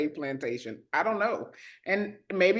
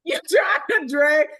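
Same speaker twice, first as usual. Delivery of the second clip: laughing; 7 semitones higher, 7 dB louder than usual. Real, not acted. Laughing while repeating.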